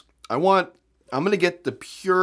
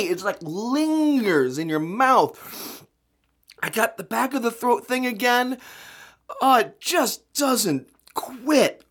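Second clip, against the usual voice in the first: slow, higher, suffering drawl; 7 semitones higher, slower than usual. Real, not acted. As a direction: drag the words in misery. Suffering the aftertaste.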